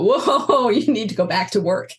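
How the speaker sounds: startled whoa, then laughing through the words; mock shock at the mirror, giggling; laughing at herself. Looking in the mirror after a month off, laughing startled. Sees her aging face, laughs.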